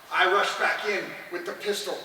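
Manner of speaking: ordinary talk, nothing marked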